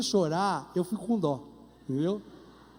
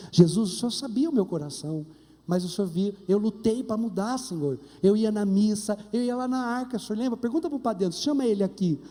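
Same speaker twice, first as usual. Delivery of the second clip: confessional prayer; 4 semitones lower than usual. Pretend. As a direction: pour out a guilty confession to Jesus.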